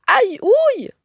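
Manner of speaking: very high, loud ouch cry; a kicked parrot yelps in pain. A narrator's reading. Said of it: "hurle-t-il".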